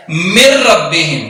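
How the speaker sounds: من ربہم slowly with no ن, the ر doubled; n merged into r. Shown as a demonstration. Teacher demonstrates the hidden ن slowly. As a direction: drop the ن and lean on a doubled ر. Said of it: "یہاں ہم نے پڑھنا ہے".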